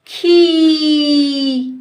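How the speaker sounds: खीर with a very long, loud ee. Shown as a demonstration. Teacher spells out the word for pupils, hugely stretched. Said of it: "तो इसको बोलेंगे".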